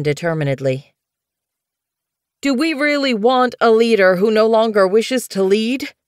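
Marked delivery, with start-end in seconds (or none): 2.4-5.9 put-on young cat's challenge, much higher and louder; challenging. A narrator's reading. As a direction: throw the question down, nervous but defiant. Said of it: "he challenged".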